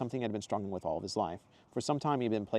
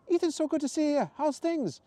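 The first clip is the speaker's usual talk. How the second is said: much higher, louder cheerful woman's voice; Zoe's exclamation; 5 dB louder than usual. Acted. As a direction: greet him brightly and high. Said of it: "Zoe exclaimed".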